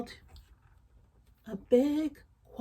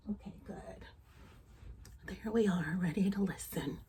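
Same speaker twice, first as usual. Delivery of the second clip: whisper; 4 semitones lower, 9 dB quieter than usual. Real, not acted. Settling the little listener, soft whisper. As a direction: breathe the words, no voice.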